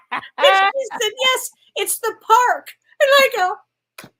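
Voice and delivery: high, loud, slow through laughter; words stretched by giggling; laughing while talking. Real, not acted. Amused by her own small town story, laughing through it.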